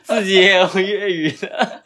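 giggling through words; laughing. Real, not acted. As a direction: laugh while talking.

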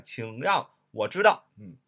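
usual speaking voice

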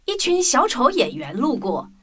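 plain reading voice

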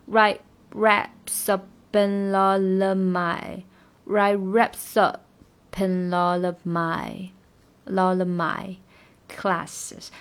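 slow, words run together; slow linking demo. Shown as a demonstration. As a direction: link them slowly. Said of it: "它前面都有连读". (慢速连读)

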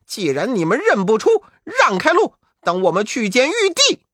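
shouting to make the guards stand aside; Monkey King shouting. Acted. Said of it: "吆喝道".

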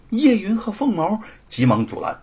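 usual speaking voice

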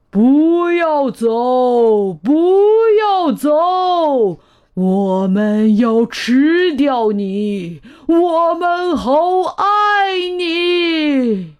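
slow, drawn-out wail of the wild things; high, wailing monster chorus; loud pleading cry. A narrator's reading. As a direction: beg him to stay, stretching every phrase. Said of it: "野兽们大声喊".

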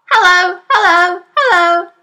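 high, loud parrot call. Acted. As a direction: squawk it down the chimney. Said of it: "the bird called down to her".

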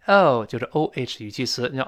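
ordinary talk, nothing marked

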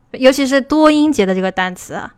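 ordinary talk, nothing marked

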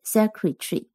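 secretary with the first syllable stressed. Shown as a demonstration. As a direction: lean on the sec of secretary. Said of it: "重音在低音节". (重音在第一音节)

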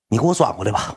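ordinary delivery

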